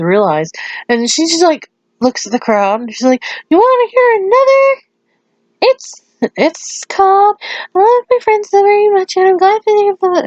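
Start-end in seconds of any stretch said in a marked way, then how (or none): 3.5-10.3 very high, perky stage voice; imitating a bubbly entertainer addressing fans; excited performer. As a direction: squeal the song title, rushing at the end. Pinky announces next song to crowd.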